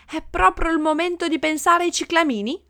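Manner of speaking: high, loud incredulous question; indignant retort. A narrator's reading. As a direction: snap back in disbelief at such frivolity. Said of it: "ribatté l'avvocato".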